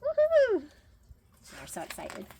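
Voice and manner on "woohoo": very high, loud whoop of joy; cheering woohoo. Acted. Chickens cheering at the news, loud whoop.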